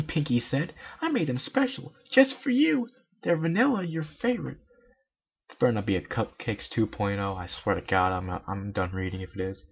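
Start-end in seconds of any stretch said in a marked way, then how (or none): 1.0-4.6 very high, bubbly cartoon pony; Pinkie Pie voice. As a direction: squeak it out like a hyper party pony.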